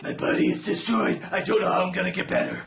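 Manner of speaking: low, rough, half-voiced hero; Bruce Wayne. Bruce Wayne despairing in prison, low rough voice. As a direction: groan it out as a broken hero. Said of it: "And Bruce Wayne is like".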